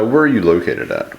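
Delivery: ordinary talk, nothing marked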